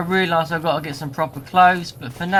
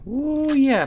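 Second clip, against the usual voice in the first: excited ooh; 10 semitones higher than usual. Real, not acted. Excited reaction to dog meat.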